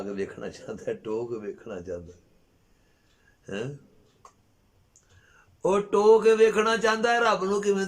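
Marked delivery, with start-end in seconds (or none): 5.6-7.9 high, loud challenge to the listeners; preacher's raised, incredulous voice; incredulous outcry. Real, not acted. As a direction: exclaim it in loud, high-pitched disbelief. Preacher exclaims at absurd wish.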